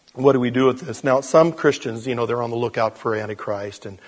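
ordinary talk

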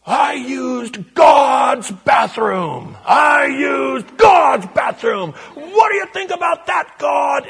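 very high, slow, booming shout; possessed man shouting at God through the church window, hammed-up; imitated yelling. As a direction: yell it at the top of your lungs. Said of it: "he was yelling at the top of his voice".

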